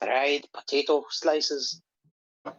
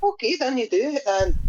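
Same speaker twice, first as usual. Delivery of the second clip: mock mam giving in; 9 semitones higher than usual. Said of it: "And she's like".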